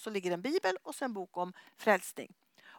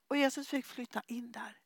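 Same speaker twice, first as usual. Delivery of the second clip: hushed whisper; 5 dB quieter than usual. Real, not acted. Preacher tells congregation of conversion, whispering. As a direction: whisper it tenderly, almost secret.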